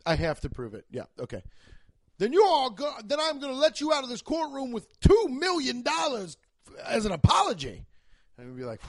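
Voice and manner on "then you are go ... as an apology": louder, hammed-up judge ruling. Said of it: "the judge is gonna be like".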